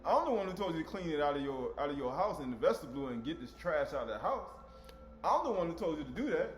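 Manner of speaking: normal talking voice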